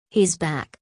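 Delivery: a lightly voiced scream of alarm. A narrator's reading. Dunbar screaming again to the ward, mild scream. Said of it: "Dunbar screamed again".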